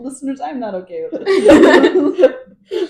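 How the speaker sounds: loud, high and slow through laughter; laughing protest. Real, not acted. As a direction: protest to the audience while cracking up.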